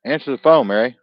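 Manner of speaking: loud call telling Mary to pick up. Real, not acted. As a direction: shout it across the room. Calling across room to Mary.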